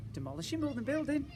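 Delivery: plain reading voice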